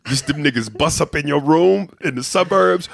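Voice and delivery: ordinary talk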